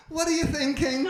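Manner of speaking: high, loud expectant wife; the wife, prying; wife asking. Retold or imitated. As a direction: prod him for a romantic answer.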